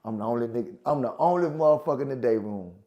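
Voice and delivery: ordinary delivery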